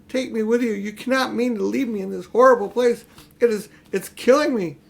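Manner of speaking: a weakened queen begging to be taken along; faint pleading. A narrator's reading. Witch begs the children to take her with them, faint plea. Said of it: "in a faint voice".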